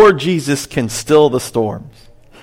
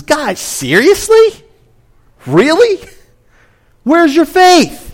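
much higher, slow and disbelieving; incredulous rebuke; 13 semitones higher, slower than usual. Retold or imitated. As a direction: play a sleepy Jesus who can't believe them. Jesus voiced waking, exasperated.

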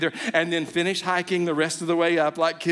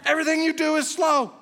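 much higher, urgent, teasing parents; insistent parents; 10 semitones higher than usual. Retold or imitated. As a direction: plead with the baby, climbing higher toward the end. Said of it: "they were going".

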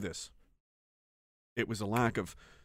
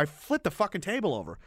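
ordinary delivery

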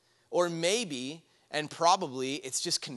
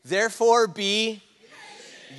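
louder, higher, drawn-out prompt; rally the room with a big, slow lead-in; more enthusiasm; 6 semitones higher, 7 dB louder, slower than usual. Shown as a demonstration. Preacher prompting congregation to answer, loud and enthusiastic. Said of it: "with a little more enthusiasm".

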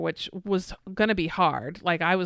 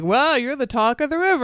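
mock-amazed teasing, louder; a needling show of fake awe; playing it up; 6 semitones higher, 6 dB louder than usual. Husband teasing her after the flip, mock-amazed and louder. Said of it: "you're like playing it up".

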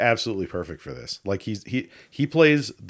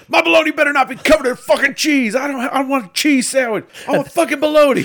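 high, loud outraged shouting; hammed-up screaming rant; angry yelling; 8 dB louder than usual. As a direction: yell it like a furious caller, pitch way up. Acted angry caller ranting about cheese.

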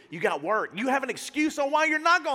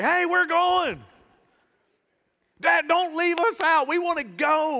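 put-on loud insistent kids; 8 semitones higher, 6 dB louder, slower than usual. Kids insisting on coming along.

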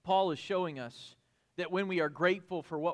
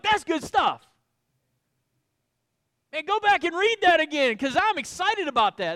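higher and louder, bubbling over; excited Paul; 12 semitones higher, 11 dB louder than usual. Retold or imitated. Paul urging readers to reread, excited. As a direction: hype up your own argument. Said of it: "he's like".

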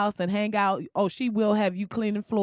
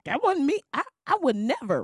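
mock Trump denial; 5 semitones higher than usual. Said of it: "Trump good for".

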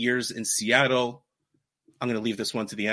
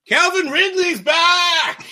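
big high joyful yell, drawn out; excited shout; 10 dB louder, much slower than usual. Real, not acted. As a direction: shout the news out, thrilled. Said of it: "I'm gonna go back from the mic a little bit".

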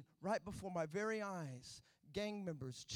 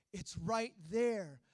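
slow, patient; 2 semitones higher, 9 dB louder, much slower than usual. Acted. God answers patiently.

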